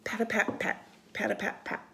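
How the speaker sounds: ordinary delivery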